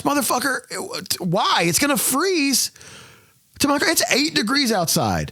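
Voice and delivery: high, slow exasperated rant. Real, not acted. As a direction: vent about the freezing cold. Ranting about freezing gas pump.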